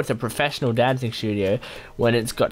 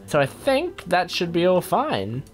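usual speaking voice

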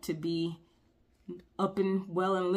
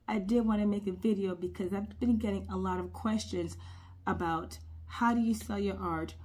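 normal talking voice

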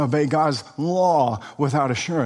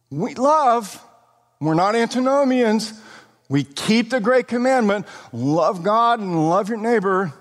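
higher-pitched voicing of a boastful believer; self-assured claim; 6 semitones higher than usual. Preacher voices congregation claiming they keep the great commandment, high self-assured mimicry. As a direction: put on a high, insistent self-righteous claim.

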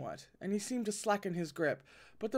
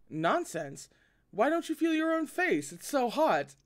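teasing exclaim; 8 semitones higher, 3 dB louder than usual. Acted. Teasing Lan Zhan about blushing.

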